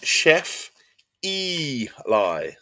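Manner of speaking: "Chef Eli" slowly in separate pieces, EE-lie; careful name pronunciation. Shown as a demonstration. Streamer checking a viewer's name, slow careful pronunciation. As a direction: split "Chef Eli" with a pause, long EE. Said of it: "Is that correct?".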